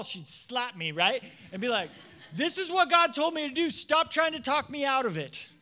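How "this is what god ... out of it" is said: loud, higher, fierce and certain; indignant rebuke. Acted. Woman rebuking the pastor who talks her out of giving, fierce. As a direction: rebuke him for getting in God's way.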